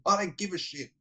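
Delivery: put-on high, bratty teenage snap; mock defiant teen. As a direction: toss it off like a sulky teenager. Daughter snapping back in retold scene.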